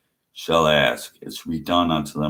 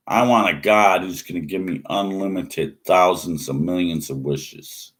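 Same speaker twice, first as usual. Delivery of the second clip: ordinary talk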